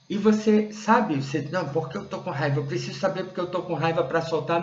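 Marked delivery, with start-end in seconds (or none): 1.5-4.6 quick, higher-pitched fretting; mock insistence. Listener needing to know why they're angry, mock insistence. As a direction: imitate someone hurriedly demanding a reason. Mimics the listener's anxious objection.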